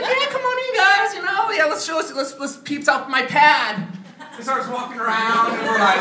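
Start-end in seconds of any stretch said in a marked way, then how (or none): 0.0-3.9 much higher, girlish mock voice of the fifty-year-old gal. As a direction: play her as a woman acting twelve, pitched way up. Gal's voice acted again.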